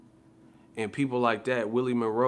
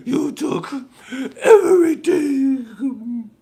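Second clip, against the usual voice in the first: gasp, then a long, slow, much higher, much louder wail; hammed-up anguished cry; 13 semitones higher, 11 dB louder, much slower than usual. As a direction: overact a movie loss, crying out the last word. Dramatic movie villain lament.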